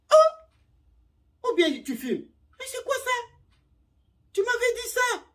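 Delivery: very high, loud outraged squeal; the suitor shocked to find her drinking tequila; shocked man. Retold or imitated. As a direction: catch her red-handed, aghast.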